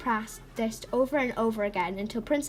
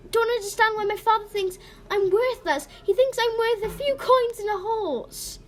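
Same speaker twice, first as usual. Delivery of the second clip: very high, loud wail; tearful outburst; 11 semitones higher, 5 dB louder than usual. Acted. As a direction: pour out the hurt, close to crying. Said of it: "began to cry loudly".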